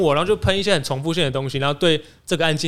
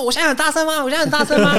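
much higher, louder indignant repeat; mock high-pitched outrage; 14 semitones higher, 6 dB louder than usual. Boss screeching back at her in the office, much higher and louder. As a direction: squeal the question like an offended boss. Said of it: "音调直接飙高这样".

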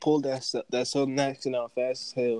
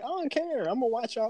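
high, unbothered mock voice; dismissive quote; 9 semitones higher, 6 dB quieter than usual. To fighting Twitter crowds, a high unbothered shrug. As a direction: toss it off like you couldn't care less. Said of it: "I'd just be like".

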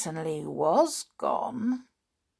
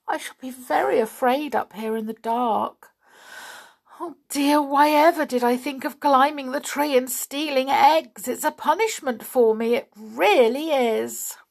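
higher, louder self-pitying wail; tearful; 6 semitones higher, 5 dB louder than usual. A narrator's reading. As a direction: lament it, close to tears. Doll frightened alone at night.

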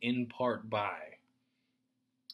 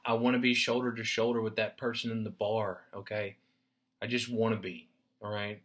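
ordinary talk

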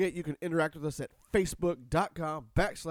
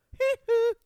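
high laugh; 6 dB louder than usual. Pretend. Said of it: "open up the pipes".

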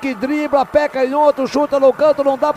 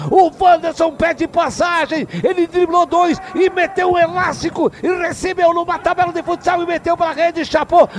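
higher, rapid raving commentary; excited praise; 4 semitones higher than usual. Real, not acted. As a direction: gush over the goal, still high. Narrator raves over the goal.

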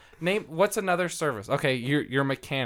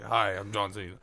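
lightly voiced John Cena, a touch lower; a hint of the wrestler introducing himself; mild John Cena impersonation; John Cena impression; 7 semitones lower, 3 dB quieter than usual. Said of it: "And John Cena's like".

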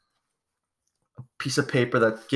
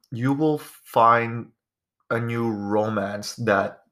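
slow dramatic reading; much slower than usual. Acted. Reading out fortune cookie.

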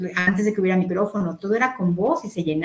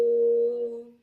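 sustained vowel U; 4 semitones higher, 3 dB quieter than usual. Shown as a demonstration. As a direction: hold a long, high "U" on one breath. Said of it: "Letra U".

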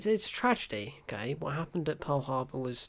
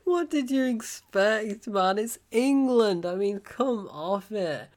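high, slow, drawn-out incredulity; mock exasperation; 6 semitones higher, 4 dB louder, slower than usual. Reviewer scolding soldier surprised England is cold, mock exasperation. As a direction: stretch the words in disbelief.

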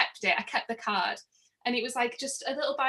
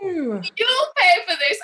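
loud, slow, higher mock demand; imitating angry guest; 8 semitones higher, 11 dB louder than usual. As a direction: bark the demand down at her. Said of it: "he was, like, shouting down at me".

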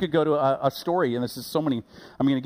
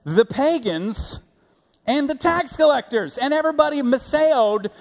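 rising to a much higher, louder cry; building preaching; 11 semitones higher, 5 dB louder than usual. Real, not acted. Preacher answering his own question, building to a loud cry. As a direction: build to a full preaching peak. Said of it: "Who? Who?".

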